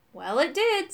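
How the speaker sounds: a touch of weary resignation; with a sigh. A narrator's reading. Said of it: "said Urk with a sigh".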